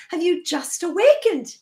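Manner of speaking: ordinary talk, nothing marked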